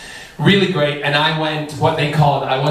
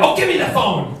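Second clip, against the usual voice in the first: impatient manager; 9 semitones higher than usual. Retold or imitated. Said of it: "And he went".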